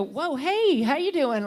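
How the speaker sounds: sudden loud, high "whoa, hey"; mock startled greeting. Noticing your partner after weeks, loud mock surprise. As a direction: jolt awake to your partner.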